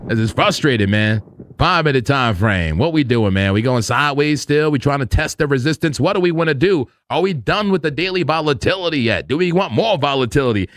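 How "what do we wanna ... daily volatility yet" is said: ordinary talk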